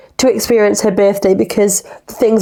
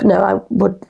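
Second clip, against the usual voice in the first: ordinary delivery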